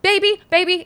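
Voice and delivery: high, loud, insistent calling; loud urgent call. Retold or imitated. Jumping on the bed to wake partner, loud call. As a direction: re-enact trying to wake a partner. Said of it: "like, loud".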